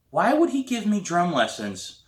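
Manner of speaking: ordinary delivery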